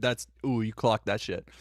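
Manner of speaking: ordinary talk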